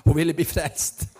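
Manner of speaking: happy outburst tipping into laughter; joyful laughing exclamation. Real, not acted. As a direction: exclaim it with real delight, laughter following. Joyful telling, laughs right after.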